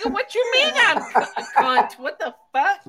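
high, hurried mock outrage, half laughing; retold upset outburst. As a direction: replay being upset, laughing through it. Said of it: "But I was upset".

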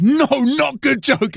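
high, slow, drawn-out protest; Flo. Retold or imitated. Prankster pranked back as he leaves stage, high mock upset. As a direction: imitate a put-out prankster wailing.

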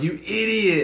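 high, teasing put-down in a retold story; mock insult. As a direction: imitate a friend's high-pitched jeer. Imitating Kyle's insult in a story.

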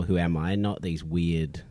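ordinary talk

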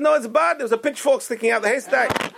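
high-pitched comic British gentleman; British accent. Retold or imitated. Two English gentlemen talking in the bar, hammed-up British accent. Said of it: "He puts on this British accent".

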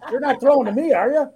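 mock player on the sideline; Cutler. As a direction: play a reluctant receiver hoping to be left alone. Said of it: "here's Cutler on the option play".

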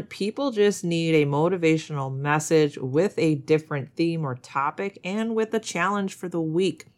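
usual speaking voice

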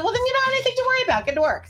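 high, fast mock-boss "get to work"; hammed-up bossy order; retold brisk boss. Her own retold order.

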